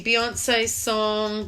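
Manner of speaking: ordinary delivery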